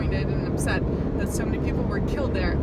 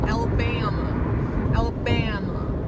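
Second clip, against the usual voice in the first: slow stretched Alabama drawl on "Alabama"; Alabama accent; slower than usual. Retold or imitated. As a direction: draw out every vowel of "Alabama" like a friend from Alabama. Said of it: "the Alabama accent".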